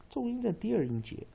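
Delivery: normal talking voice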